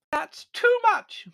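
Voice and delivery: very high, slow outraged scream. A narrator's reading. Countess outraged by servant's insults, screaming. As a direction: scream it, drawing out the words. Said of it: "she screamed".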